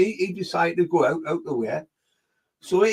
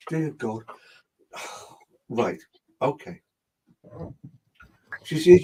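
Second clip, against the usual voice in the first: normal talking voice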